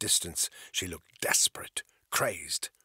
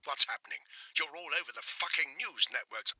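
curt; 8 semitones higher than usual. A narrator's reading. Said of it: "The C O's voice sounded curt".

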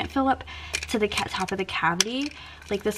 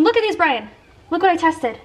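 higher, louder call to the next room; 7 semitones higher, 8 dB louder than usual. Real, not acted. Calling Brian to see the test melts, an eager call. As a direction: call Brian over, proud.

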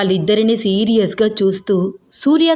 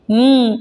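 short closed-mouth hum of yes; mm-hmm, just humoring her; humming assent; 3 semitones higher, 3 dB louder, slower than usual. A narrator's reading. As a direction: hum a lazy yes through closed lips. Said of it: "ఊ కొడుతున్నాడు".

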